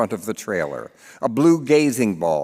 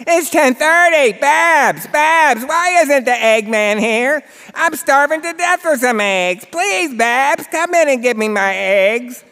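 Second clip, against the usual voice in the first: high, loud, whining demand for eggs; whiny old mother; 5 dB louder than usual. Acted. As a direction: wail for your eggs like a spoiled old mother. Mother Edie demanding her eggs.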